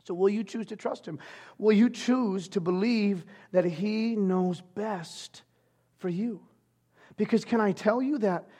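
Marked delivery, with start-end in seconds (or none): none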